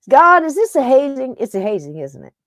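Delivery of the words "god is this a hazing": mock outrage. Real, not acted. Hung up on again.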